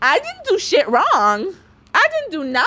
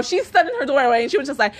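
usual speaking voice

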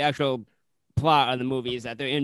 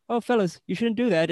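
mild warning; 8 semitones higher than usual. Retold or imitated. Ken voiced warning the tourists.

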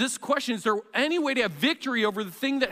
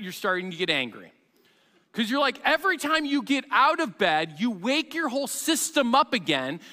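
ordinary talk, nothing marked

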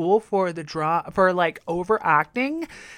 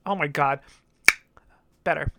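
normal talking voice

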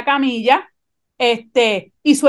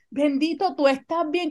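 much softer, gently pitying; tender doctor; 3 semitones higher, 7 dB quieter than usual. Retold or imitated. Doctor comforts injured patient, soft and tender. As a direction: soothe her like a suspected abuse victim. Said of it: "con esta ternura".

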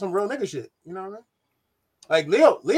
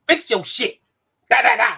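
higher, imitated tough-love scolding; hardcore pep talk; 4 semitones higher than usual. Friend retelling her pep talk in Jamaica, hardcore. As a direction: mimic her blunt telling-off. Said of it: "Even my pep talk was hardcore".

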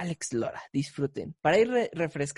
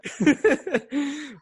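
laughing; 8 semitones higher, 3 dB louder than usual. Real, not acted. Laughs about the censorship joke.

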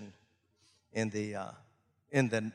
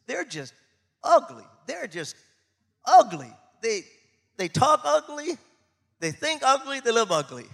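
loud, high punches on ugly; preaching emphasis; 8 semitones higher, 5 dB louder than usual. Acted. Pastor describing hard neighbors, loud punched ugly. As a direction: hit each ugly hard.

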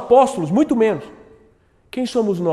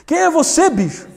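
casual question with bicho tacked on; slangy challenge; 8 semitones higher than usual. Shown as a demonstration. Pregador refaz a pergunta com gíria, tom despojado. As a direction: ask it like a street kid. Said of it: "Eu colocaria na frente".

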